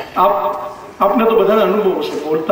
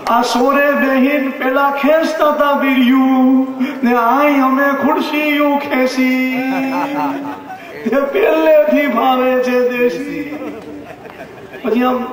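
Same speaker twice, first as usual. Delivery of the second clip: lilting, half-sung comic verse, pitched up; satirical poem recital; 5 semitones higher than usual. Acted. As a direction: recite it like a mocking folk song to the crowd. (વ્યંગ્ય કાવ્યનું લયબદ્ધ પઠન)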